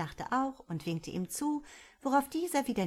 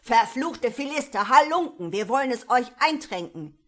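furious shouted curses, louder on Halunken; angry student riot yell; raging mob; 5 semitones higher, 11 dB louder than usual. A narrator's reading. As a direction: storm at the townsfolk. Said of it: "tobte es durcheinander".

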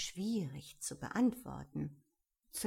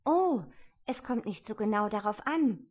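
bright-pitched, louder hasty answer; eager, hurried reply; quick reply; 3 semitones higher, 7 dB louder than usual. A narrator's reading. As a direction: answer promptly as Alice. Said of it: "erwiderte Alice schnell".

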